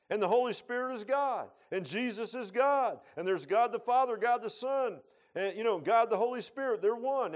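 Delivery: loud, high, ringing preacher's cry; preaching at full force. Acted. Pastor preaching loud and high to his congregation. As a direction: lift the church with each line, high and loud.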